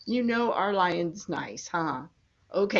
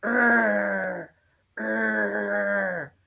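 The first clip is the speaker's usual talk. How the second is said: animal roar; 2 semitones lower than usual. Shown as a demonstration. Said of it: "Can you make 'em together".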